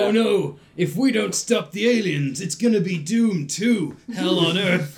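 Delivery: hammed-up movie hero. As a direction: overact the doomed hero's alarm. Mock movie line quote.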